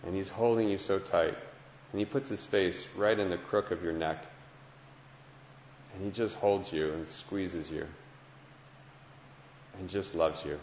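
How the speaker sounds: softly guiding a prayerful meditation; guided meditation. Acted. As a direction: lead them gently through the picture.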